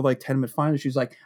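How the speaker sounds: ordinary talk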